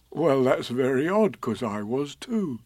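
a touch of a slow teddy bear voice; gentle bear asking a friend; mild Pooh voice; lightly voiced Pooh bear.

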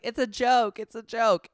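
high, loud mock protest. Host imitates Sandoval brushing off a remark, high-pitched protest.

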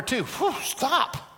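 high, puffed "whew, stop"; overfull protest. Pretend. Stuffed preacher begs friends to stop serving ribeye, overfull protest. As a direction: beg off after one plate too many. Acting full after too much food.

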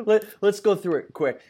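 laughing while talking. Real, not acted. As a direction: still laughing through the words. Laughing after Pixar joke.

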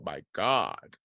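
ordinary talk

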